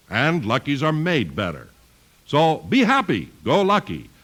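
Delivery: ordinary delivery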